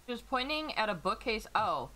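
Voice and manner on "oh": ordinary talk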